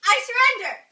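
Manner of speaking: high, loud cry of surrender; yelled surrender. Acted. Beaten Irish king shouting to Arthur, loud surrender. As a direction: shout that you give up.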